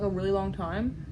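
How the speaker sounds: ordinary talk, nothing marked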